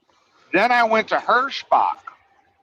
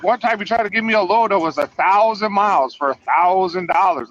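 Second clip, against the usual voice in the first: ordinary delivery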